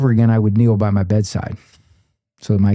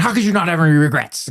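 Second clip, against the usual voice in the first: higher-pitched, mildly indignant challenge; upset, retold; 6 semitones higher, faster than usual. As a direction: mimic a friend's put-out disbelief. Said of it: "he got upset".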